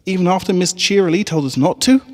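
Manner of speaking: a doubtful little pony teasing her friend; high filly voice. Acted. Scootaloo doubting Apple Bloom's speech, high and skeptical.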